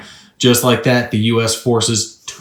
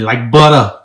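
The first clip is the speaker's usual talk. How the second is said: Boston accent; 5 dB louder than usual. Shown as a demonstration. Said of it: "How do you say it in that, that Yankee Boston accent?".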